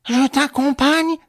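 high, loud, drawn-out shout; calling out. A narrator's reading. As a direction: call to someone in another room. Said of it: "La revendeuse cria de l'intérieur".

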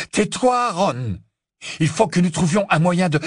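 high, loud, exasperated order; urgent shout. A narrator's reading. Harry trying to calm frantic Ron, urgent shout. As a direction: make him listen over his own screaming. Harry arguing over Ron's screams.

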